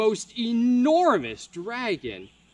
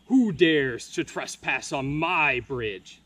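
slow, lower troll voice, a bit louder; hungry troll; 4 semitones lower, 5 dB louder than usual. Acted. Troll guarding his bridge, menacing. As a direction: loom over the intruder like a troll. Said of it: "inquired a hungry troll".

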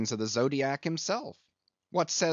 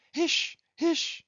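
shrill crazed hushing; high hissing hush. Acted. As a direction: hush everyone wildly. Pip hushing wildly.

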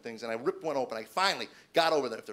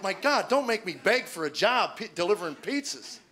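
loud, higher begging outburst; exasperated plea; 6 semitones higher, 5 dB louder than usual. Retold or imitated. Man begging for a pizza delivery job, exasperated plea. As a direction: protest in raised, exasperated disbelief.

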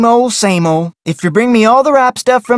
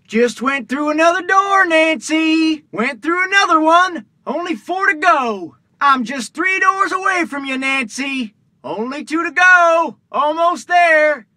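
eerie distant calling; 6 semitones higher, 3 dB quieter than usual. Acted. Unseen caller approaching through doors.

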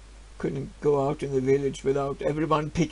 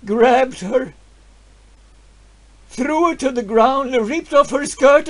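anguished outrage; 8 semitones higher, 8 dB louder than usual. Acted. Recounting wife's assault.

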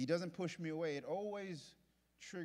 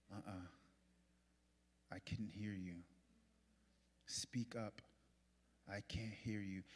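slow and softer, with a whispered "speak up"; soft straining voice; 6 semitones lower, 7 dB quieter than usual. Shown as a demonstration. Preacher acting a listener straining to hear, slow and soft. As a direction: lean in and ask quietly, almost whispering. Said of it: "they talk super soft like this".

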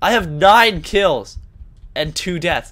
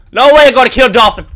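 louder, faster protest; 5 semitones higher, 10 dB louder, faster than usual. Real, not acted.

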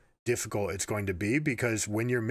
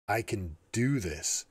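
"do" stressed, slow delivery; slow mantra with the weight on "do"; 2 semitones lower, slower than usual. Shown as a demonstration. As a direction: hit "do" firmly. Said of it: "inflect on each of the different words".

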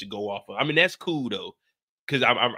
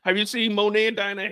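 higher, lightly voiced Drew; Drew on the phone; 8 semitones higher than usual. Drew calls Tariq asking about Monet and Dinah, higher. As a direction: play Drew calling, pitched up. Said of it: "Drew calling Tariq".

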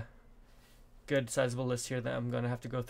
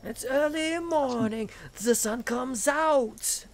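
slow, high, sung mock German accent; German rocker impression; 11 semitones higher, 4 dB louder, much slower than usual. Imitating the Scorpions singer's German accent, slowly sung. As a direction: croon it like a German hard rock singer. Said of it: "He has a super thick German accent".

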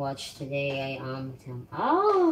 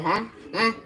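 usual speaking voice

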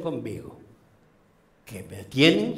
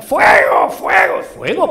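frantic shout, much higher and louder; desperate cry of fire; 12 dB louder, slower than usual. Acted. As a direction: yell for help at the top of the lungs. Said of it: "empieza a gritar".

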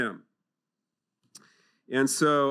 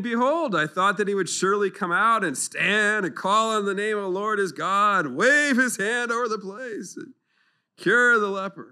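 angry Naaman; 5 semitones higher, 4 dB louder than usual. A narrator's reading. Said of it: "Naaman was angry".

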